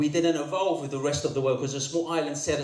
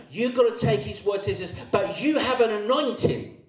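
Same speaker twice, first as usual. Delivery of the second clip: high, driving sermon voice; full-force preaching; 7 semitones higher, 3 dB louder than usual. Real, not acted. Preacher urging the congregation on the anointing, full-force preaching. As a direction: preach it urgently, pitched well up.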